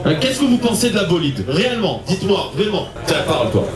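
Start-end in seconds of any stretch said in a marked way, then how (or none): none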